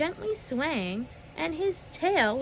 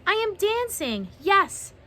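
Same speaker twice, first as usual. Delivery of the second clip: high, loud delighted exclaiming; joyful cry; 7 semitones higher, 4 dB louder than usual. A narrator's reading. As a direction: shout out pure joy. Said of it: "Gerald cried".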